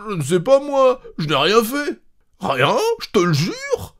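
high, pleading protest of innocence; pleading denial. Acted. Husband swearing to wife he didn't burn it, pleading. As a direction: swear you did nothing, begging.

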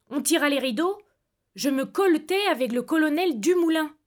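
plain reading voice

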